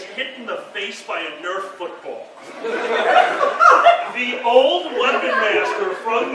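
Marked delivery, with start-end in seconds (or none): 2.3-4.1 high burst of laughter; big, high-pitched laugh. Real, not acted. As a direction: crack up laughing. Audience and teller laugh.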